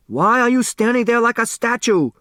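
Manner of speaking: loud high angry shout at his son; father scolding his frozen son. Acted. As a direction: yell at the boy in frustration. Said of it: "Hu shouted at his son".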